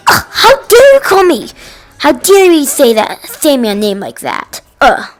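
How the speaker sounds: mock Karen. Acted quote of angry Karen.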